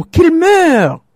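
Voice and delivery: a sudden high, loud curse; crying out. Acted. The young wife cursing the snake with a sharp high cry. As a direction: fling the words out like a spell. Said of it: "en s'écriant".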